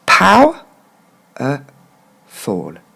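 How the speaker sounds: "Pow-, e-, fall" in three spaced parts; syllable by syllable. Shown as a demonstration. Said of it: "on reprend encore une fois".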